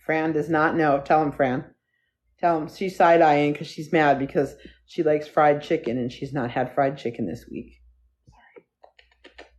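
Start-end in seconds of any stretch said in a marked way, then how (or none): 6.0-9.4 whispered aside. Real, not acted. Telling viewers the dog's fried chicken secret, whispered. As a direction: whisper it, fully unvoiced, like a secret.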